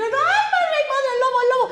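very high, laughing panicky squeal; high laughing piglet fleeing the wolf; panicked little pig. A narrator's reading. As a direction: squeal in mock fright. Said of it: "los cerditos dijeron".

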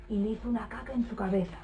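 fast, soft whisper. Acted. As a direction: whisper it like a secret. Naughty climax told to kids.

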